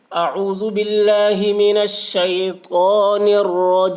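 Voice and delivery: slow, loud chanted tajweed; Quran-style chant; Quranic recitation. Shown as a demonstration. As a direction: recite it melodically, drawing out each word. Quran recitation opening lesson.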